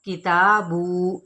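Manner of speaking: किताबू with the final ू stretched out; slow, wrongly lengthened किताबू; overlong vowel. Shown as a demonstration. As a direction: drag out the last vowel too long. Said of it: "ये भी गलत तरीका है".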